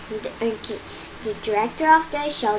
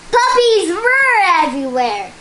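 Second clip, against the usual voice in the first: excited; 6 semitones higher, 5 dB louder than usual. A narrator's reading. Excited reading of chaos.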